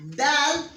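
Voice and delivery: teacher highlights the letter dal; model dal. Shown as a demonstration. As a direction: punch out dal as a demo. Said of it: "And for".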